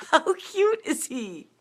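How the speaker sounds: high, drawn-out squeal over something cute; gushing coo. Real, not acted. Runner shows viewers a cute find, cooing high.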